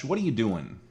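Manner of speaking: normal talking voice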